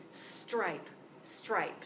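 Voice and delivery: "stripe" with S, T, R run tight together; full cluster "stripe". Shown as a demonstration. Teacher completes buildup, models "stripe" for students. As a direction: model the finished word "stripe" clearly. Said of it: "Now add the S".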